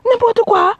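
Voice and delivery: high, sharp brush-off; scornful scoff. Real, not acted. Coach mocking a naive woman, scornful.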